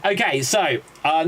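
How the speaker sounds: ordinary delivery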